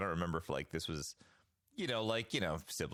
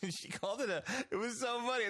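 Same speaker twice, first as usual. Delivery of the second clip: ordinary talk, nothing marked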